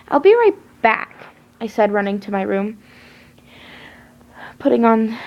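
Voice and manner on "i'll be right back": much higher, louder urgent call. A narrator's reading. Girl rushing off to find the killer, urgent. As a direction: blurt it while rushing off. Hurried exclamation.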